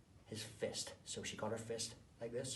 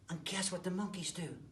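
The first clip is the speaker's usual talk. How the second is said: ordinary delivery, nothing marked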